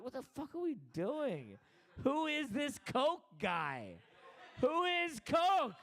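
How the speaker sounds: high, slow, rising disbelief; mock panic. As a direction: act like you're realizing you're in over your head. Panicking in a CIA-like room.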